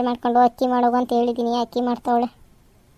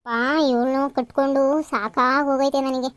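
usual speaking voice

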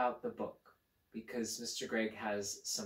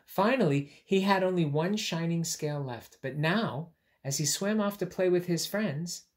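higher, louder storytelling voice; storybook narration; 7 semitones higher, 6 dB louder than usual. A narrator's reading. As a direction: tell the happy ending brightly.

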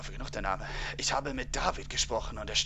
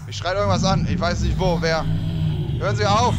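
loud, raised panicked yelling; panicked shouting; 5 semitones higher, 11 dB louder than usual. Real, not acted. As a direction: shout over the noise. Something is shouting at him. (lautes, panisches Rufen)

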